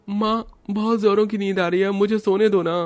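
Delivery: put-on child voice nagging to be left alone; sleepy child whine. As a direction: whine like a drowsy kid begging mom. Narrator voices sleepy child Raj.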